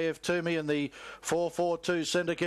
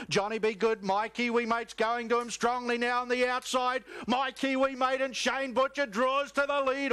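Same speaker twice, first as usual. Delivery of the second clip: higher and higher as the winner draws clear; excited finish call; 6 semitones higher than usual. Real, not acted. Caller to the crowd at the finish, excited rising call. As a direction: lift the call to a peak at the line. Home straight finish of race.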